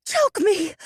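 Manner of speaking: high breathy woman's demand. A narrator's reading. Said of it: "in a high, breathy voice".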